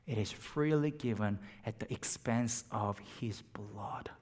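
reverent whisper. Real, not acted. The preacher whispers to the congregation in awe. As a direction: breathe it out in awe.

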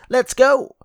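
very high, loud cartoon-heroine cheer; Mirabel impression. Host repeating her Encanto catchphrase, high Mirabel impression. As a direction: pitch it way up and belt it out like an Encanto character. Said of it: "Mirabel impression".

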